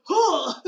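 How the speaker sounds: loud sharp effort grunt. Acted. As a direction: huff as she grabs him. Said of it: "just go".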